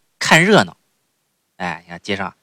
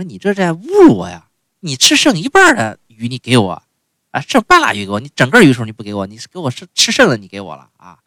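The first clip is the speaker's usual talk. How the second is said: raised, louder voice of an indignant princess; angry daughter; 4 semitones higher, 6 dB louder than usual. Retold or imitated. Daughter berating her father the king over half a fish, angry. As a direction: scold him for the leftover fish, higher and louder. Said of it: "就非常生气".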